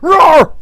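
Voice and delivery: short, loud cartoon roar; mock beastly roar; imitated roar. Voices attacker's roar while retelling.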